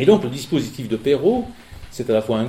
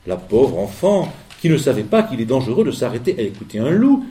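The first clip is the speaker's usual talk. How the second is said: plain reading voice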